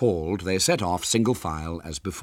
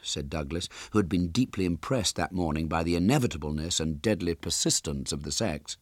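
ordinary delivery, nothing marked

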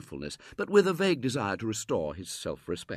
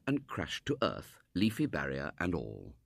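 plain reading voice

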